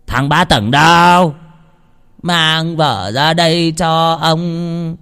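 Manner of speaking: put-on slow drunken slur from a bully landlord; bully landlord, slow drunken slurring. A narrator's reading. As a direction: drag it out like a drunk thug. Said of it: "Vẫn là cái giọng lè nhè say rượu".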